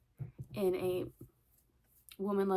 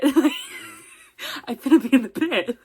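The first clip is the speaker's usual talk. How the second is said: laughing; 6 semitones higher, 15 dB louder, much slower than usual. Real, not acted. Laughing at own joke.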